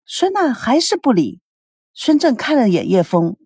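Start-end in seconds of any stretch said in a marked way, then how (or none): none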